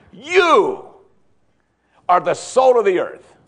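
high, loud, thrilled YOU then the rest; rising preacher's excitement on You; excited proclamation. Shown as a demonstration. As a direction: announce it like wonderful news. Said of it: "he wants them to get all excited about this".

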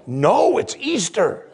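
a hint of a prim mother, hammed-up; lightly voiced mother insisting it's a special day; mock mother. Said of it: "mother's retort retold".

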